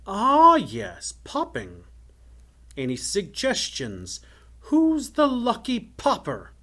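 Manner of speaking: delighted villain, leaping high then settling; gloating wizard. A narrator's reading. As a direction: toy with them, then crow the last question loudly. Wizard gloats, picks a victim.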